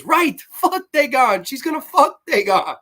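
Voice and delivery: hammed-up high-pitched exclamation; mock emphatic retort. Acts woman's loud retort.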